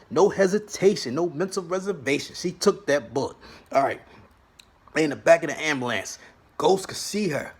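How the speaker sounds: usual speaking voice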